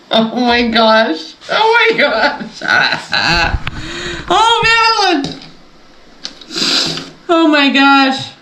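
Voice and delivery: high, delighted squeals of success; thrilled disbelief. Real, not acted. Crafter thrilled her project finally worked, squealing. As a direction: burst out with joy that it worked.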